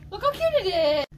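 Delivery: high-pitched excited gush; cute-object gushing. Real, not acted. Shopper showing a friend a cute find, gushing.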